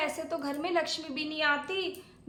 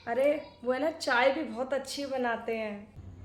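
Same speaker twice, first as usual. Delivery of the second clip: ordinary talk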